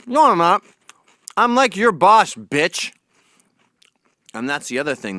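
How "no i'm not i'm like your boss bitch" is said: mock boast.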